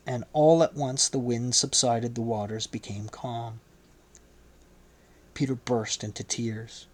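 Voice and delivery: usual speaking voice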